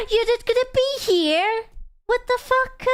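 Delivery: high, hammed-up dismay at being left; mock shock. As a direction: squeal it like a melodrama heroine.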